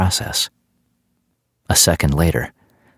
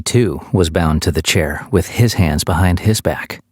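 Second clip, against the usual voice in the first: ordinary delivery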